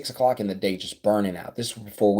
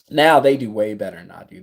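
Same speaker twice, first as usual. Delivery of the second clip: normal talking voice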